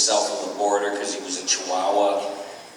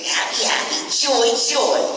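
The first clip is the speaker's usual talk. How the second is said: high, gleeful singsong cheer; Stimpy voice; 14 semitones higher, 4 dB louder than usual. Acted. Voice actor demonstrating Stimpy for fans, giddy. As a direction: chirp it like a blissful innocent cat. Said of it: "Stimpy was like".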